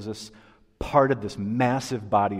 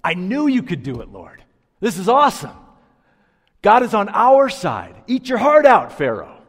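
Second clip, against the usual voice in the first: loud, high, gloating cheer; cocky triumph; 10 semitones higher, 11 dB louder than usual. Retold or imitated. Preacher voicing proud Israelites crossing the Red Sea, loud gloating cheer. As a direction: crow it like a swaggering victor, chest puffed out. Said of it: "they're saying something like".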